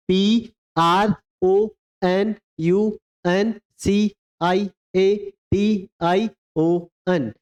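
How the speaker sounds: slow letters, each one drawn out; teacher dictating the spelling slowly; slow spelling dictation. Shown as a demonstration. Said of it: "एक बार repeat करेंगे फिर से".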